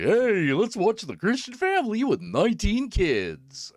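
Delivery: high, loud sing-song pitch; mock cheery promo voice. Mocking the family show's cheery invitation. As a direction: parody a wholesome TV ad. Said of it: "you know, like".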